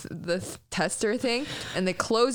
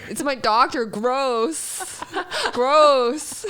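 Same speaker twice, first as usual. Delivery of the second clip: loud, high, exaggerated revulsion; mock disgust; 6 semitones higher, 5 dB louder, much slower than usual. Patient objecting to talk about their doctor, mock disgust. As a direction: protest as if grossed out, building louder.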